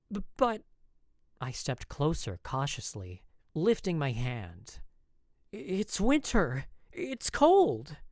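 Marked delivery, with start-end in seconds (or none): 0.1-0.6 flustered stammer. A narrator's reading. 5.5-8.0 anxious protest. A narrator's reading. Worried stammering protest.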